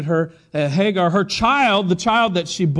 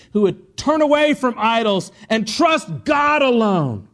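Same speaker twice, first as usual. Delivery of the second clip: raised, emphatic pulpit delivery; full-force preaching; 6 semitones higher, 3 dB louder than usual. Real, not acted. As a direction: proclaim it with conviction to the congregation.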